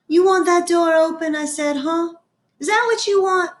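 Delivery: put-on high, coaxing young girl; girl coaxing dog. A narrator's reading. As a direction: coax the dog gently. Opal coaxing the scared dog.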